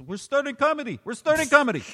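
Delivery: high, loud insistent shout; owner yelling. Retold or imitated. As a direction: drown out the whole room. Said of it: "he goes".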